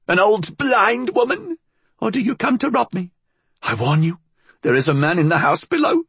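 put-on very high old woman, rapid; high, quick, hissing threat; swift vicious hiss. A narrator's reading. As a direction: fire off a venomous warning to an intruder. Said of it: "she hissed, swift, vicious".

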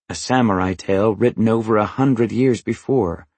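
plain reading voice

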